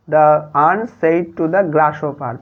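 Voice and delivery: very slow, each word apart; slow dictation. Shown as a demonstration. Teacher giving the quiz answer to pupils, very slowly. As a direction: spell it out slowly for the class.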